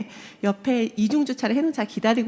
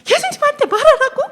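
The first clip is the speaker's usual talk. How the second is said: put-on much higher, loud little kid's voice; child's urgent cry; 10 dB louder than usual. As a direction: squeal it like a worried child. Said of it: "뭐 뒤에서 또 그러는 거예요".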